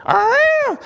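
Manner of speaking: high, loud elephant roar cry; a wild elephant's furious bellow; elephant trumpet. Retold or imitated. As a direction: trumpet like an angry trapped elephant. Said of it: "another one of those".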